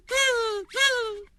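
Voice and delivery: very high squeaky tz tz tz; monkey chatter. Acted. Monkeys mocking the peddler, high squeaky chatter. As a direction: chatter back like cheeky monkeys.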